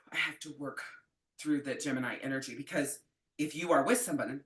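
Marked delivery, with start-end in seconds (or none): 0.1-2.6 weary sigh. Real, not acted. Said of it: "if you hear any contention in my voice".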